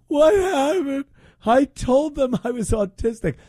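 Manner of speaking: high whiny mock voice; mimicking the protester, high and whining. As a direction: whine it like a put-upon protester. Mocks protester's whiny complaint.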